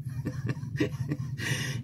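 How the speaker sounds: high, breathless giggle; high squealing laugh. Real, not acted. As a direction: burst out giggling at the punchline. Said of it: "laughs at own joke". (matinis na hagikhik)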